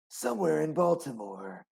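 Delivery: hammed-up movie trailer announcer. Imitating an old movie trailer.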